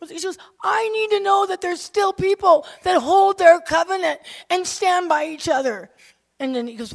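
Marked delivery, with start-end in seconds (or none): 0.6-5.9 high, loud, pleading cry; retold distraught outburst; imitating sobbing friend. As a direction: plead loudly at a much higher pitch. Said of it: "she was just sobbing".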